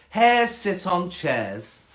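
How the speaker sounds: high, drawn-out snobby cat. Acted. Cat refusing the frog a chair, snobby and slow. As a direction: rule it out snootily and slowly. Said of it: "quite a snobby cat".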